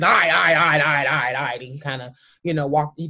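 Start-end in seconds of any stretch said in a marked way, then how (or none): 0.0-1.6 gruff dad brushing it off. Retold or imitated. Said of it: "him being the dad that he is, he's".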